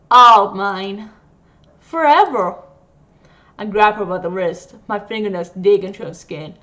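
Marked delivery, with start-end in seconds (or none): 0.1-2.6 possessive outburst. Acted. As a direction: claim him loudly and forever. Possessive nurse claims patient.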